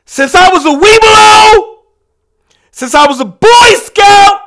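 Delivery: loud, high, indignant yelling; furious shouting rant. Acted. Comic telling the crowd off, in a loud indignant yell. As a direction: bellow your credentials like an outraged patriot.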